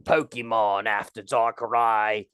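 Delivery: low put-on Cockney; hammed-up, weird Cockney celebrity guest. Guest asking to be interviewed, in a low fake Cockney. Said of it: "Cockney accent".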